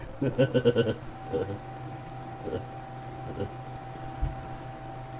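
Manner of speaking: soft breathy chuckling; quiet laughter. Real, not acted. Laughing after Doug's joke.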